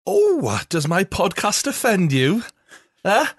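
high, drawn-out taunting question; mock indignant. Host teases offended listeners, mock indignant. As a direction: needle them in a high put-on voice.

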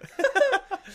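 high burst of laughter. Real, not acted. Laughing after a botched Indian accent. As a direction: laugh at your own accent attempt.

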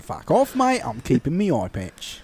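put-on a cheeky Cockney-ish British accent; mild British accent scoffing. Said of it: "And I have a British accent".

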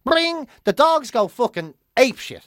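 ordinary talk

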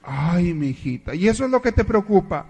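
laughing, promise hell to the son-in-law; scheming mother. Acted.

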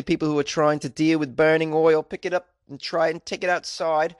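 a clumsy put-on Australian accent, slowed; an awful fake Australian accent reading a doctor's quote; bad Australian accent. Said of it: "the worst Australian accent you've ever heard".

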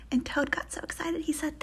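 plain reading voice

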